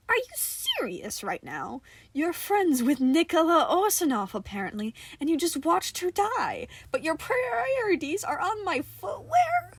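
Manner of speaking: can't believe this; incredulous disbelief. Acted. John confronts Johnny, incredulous and rising.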